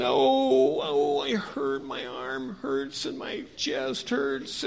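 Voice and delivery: high, slow, drawn-out moaning; hurting man. Acted. Beaten traveler lying on the trail, hurting and moaning. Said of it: "he's hurting, and he's thinking".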